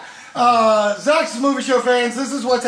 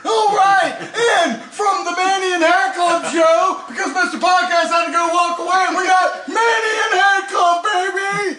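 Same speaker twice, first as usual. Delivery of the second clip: sports announcer hype; 5 semitones higher than usual. Acted. Hyped sports show host.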